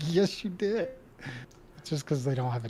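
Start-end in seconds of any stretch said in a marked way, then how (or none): 0.1-0.9 high, playful mock insisting; retold insistence. Speaker retelling school argument over y'all, high mock insistence. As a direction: argue back in a pitched-up mock voice.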